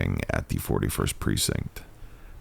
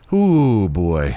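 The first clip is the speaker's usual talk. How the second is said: big ooh; 7 semitones higher, 8 dB louder than usual. Real, not acted. Streamer reacting to the unfolding scene, loud surprised ooh. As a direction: react with an amused gasp. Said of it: "reacting to the scene".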